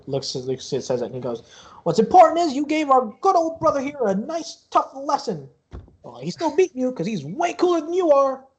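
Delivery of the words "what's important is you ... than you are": high-pitched sibling sticking up for a brother; high voice, proud of a brother; cocky sibling character. Acted. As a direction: pitch it well up, needling the opponent. Game master plays a sibling praising brother.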